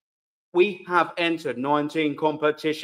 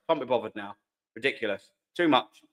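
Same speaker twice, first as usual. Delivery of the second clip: normal talking voice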